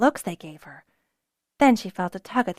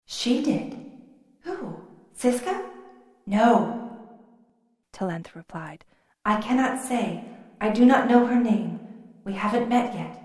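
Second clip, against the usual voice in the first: lightly voiced mind-speech of a dragon; queen dragon answering Fiona, lightly set apart; 2 semitones higher than usual. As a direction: speak like a distant dragon in the mind. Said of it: "the queen replied".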